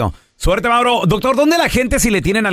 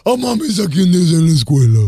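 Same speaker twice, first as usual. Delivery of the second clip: mock seven-year-old showing off his grade; kid voice; 2 semitones lower than usual. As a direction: announce it like a kid bragging to mom. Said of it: "Así era, era así".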